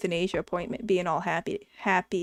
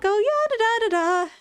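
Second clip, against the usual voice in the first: very high singsong mimicry; mock someone humming cheerily to themselves; giddy receptionist; 12 semitones higher than usual. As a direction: imitate an obliviously chirpy front-desk worker. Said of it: "was so happy and giddy".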